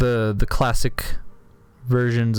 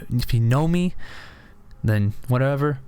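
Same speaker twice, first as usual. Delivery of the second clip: ordinary talk, nothing marked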